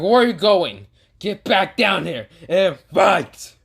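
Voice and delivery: high, loud, slow taunting yell; angry shout. Acted. Boruto hauling the villain down, shouting. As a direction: drag him back with a roar.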